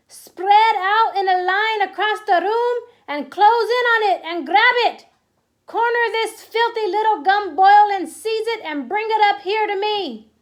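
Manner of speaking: high, loud, strange witch voice giving orders; commanding witch. Acted. Grand High Witch ordering the witches, loud witch commands. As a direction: bark orders like a cruel witch queen.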